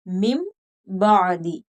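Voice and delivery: slow मिम, humming the m before बादी; n changed to m. Shown as a demonstration. Teacher repeats the correct reading, long m in मिम. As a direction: draw out मिम, closing on m. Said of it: "मीम के साथ बदल कर पढ़ेंगे".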